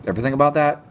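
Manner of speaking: ordinary delivery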